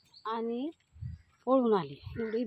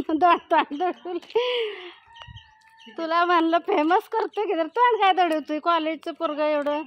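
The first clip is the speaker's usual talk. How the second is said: louder, higher teasing of a shy lad; 4 semitones higher, 6 dB louder than usual. Real, not acted. Woman teasing a college boy who hides his face, loud. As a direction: tease him loudly while filming.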